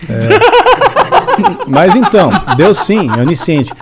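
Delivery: talking through laughter; words pushed out while laughing. Real, not acted. Still laughing after a joke.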